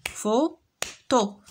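فوتو said slowly as fo-to, two separate beats; syllables clapped out. Shown as a demonstration. Teacher to pupils, counting syllables: فوتو split slowly into fo-to. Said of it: "On a deux syllabes".

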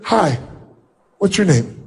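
soft, hesitant greeting, voice shrinking; a shy, nervous hello to a stranger; timid fearful demo. Shown as a demonstration. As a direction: greet them as if you fear rejection. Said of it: "if I came up and said".

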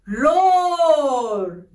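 long drawn-out roar, rising then falling; teacher's mild lion roar; lion sound. Shown as a demonstration. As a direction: stretch the word like a gentle lion's roar. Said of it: "how does a lion make sound".

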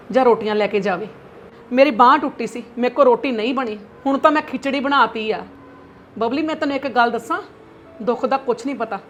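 angry lecturing mother. Acted. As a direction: scold her daughter hard. Mother lectures daughter angrily.

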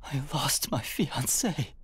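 slow, whispered grief; grieving whisper. Acted. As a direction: breathe it out in grief. Grieving widower.

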